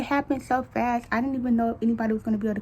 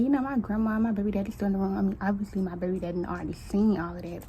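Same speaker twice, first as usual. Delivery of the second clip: usual speaking voice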